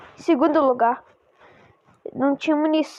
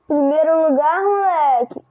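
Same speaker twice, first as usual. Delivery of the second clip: loud victory cheer; excited shout; 6 dB louder than usual. Real, not acted. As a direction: celebrate winning out loud. Winning first place, celebrating.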